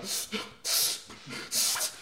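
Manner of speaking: soft sobbing gasps for breath; imitated crying gasps. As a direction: act out catching your breath while crying. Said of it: "And I'm crying. I'm like".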